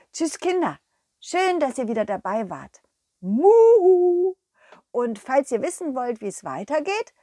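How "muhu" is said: high, loud cheerful moo; cow moo goodbye. Acted. The cow saying goodbye to the listeners with a moo.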